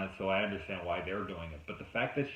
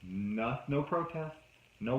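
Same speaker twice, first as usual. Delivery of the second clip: ordinary talk, nothing marked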